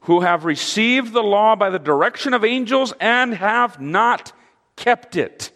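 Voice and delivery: high, forceful scriptural denunciation; harsh rebuke. Acted. Stephen charges the leaders over the law, harsh and high. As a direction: condemn them, raising the pitch throughout. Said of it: "What harsh words".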